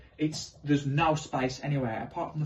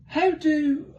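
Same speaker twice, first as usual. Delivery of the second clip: nervous laugh; 13 semitones higher, 6 dB louder than usual. Retold or imitated.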